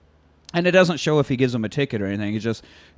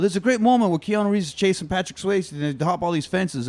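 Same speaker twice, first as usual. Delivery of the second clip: ordinary talk, nothing marked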